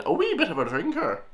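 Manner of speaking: high, lilting imitation of an Irish accent; mock Irish accent. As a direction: mimic a put-on Irish accent with big pitch swoops. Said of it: "hear this nice Irish accent again".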